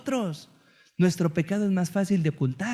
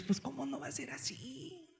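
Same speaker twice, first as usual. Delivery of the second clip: much softer, higher, to yourself; preacher voices the listener's inner thought, hushed; quiet realization; 6 semitones higher, 13 dB quieter than usual. Retold or imitated. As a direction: murmur it as a sudden understanding.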